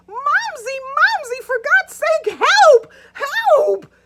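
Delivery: high, loud whiny scream for help. Retold or imitated. Jude screaming to Ruth for help, high loud whiny wail. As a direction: wail for mommy like a terrified child. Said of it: "he let out a whiny scream".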